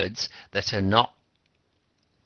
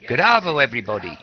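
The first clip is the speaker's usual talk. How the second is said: bright, loud radio-host hello, pitched up; Aussie radio host greeting; 2 semitones higher, 8 dB louder, faster than usual. Retold or imitated. Radio DJ greets his listeners, loud upbeat slang greeting. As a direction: play the breezy Aussie DJ opening the show. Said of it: "this guy on the radio, just starting his show".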